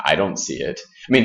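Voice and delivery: normal talking voice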